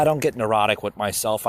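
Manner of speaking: normal talking voice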